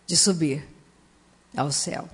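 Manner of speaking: ordinary talk, nothing marked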